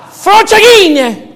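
Frocighine boomed slow and high, rolled Italian vowels; mocking the Pope's Italian word, slow and loud; hammed-up Italian word. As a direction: belt out Frocighine like an Italian outburst. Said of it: "That's the word he used".

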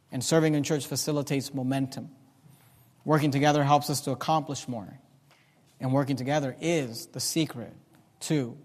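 low, soft and measured; sincere sermon close. Acted. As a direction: bring it down to quiet, earnest thanks.

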